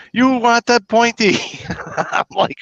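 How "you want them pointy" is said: mock Korean lady. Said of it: "She says".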